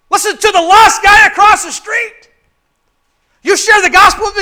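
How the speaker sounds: high, loud pulpit shout; full-force preacher; preaching shout. Acted. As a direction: call it across the room.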